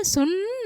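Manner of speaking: सुंदर split, long high nasal n on सुं; nasal anusvar demo. Shown as a demonstration. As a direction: hum the सुं of सुंदर, then a drawn दर. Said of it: "सुन बोलने पर अंग निकल रहा है".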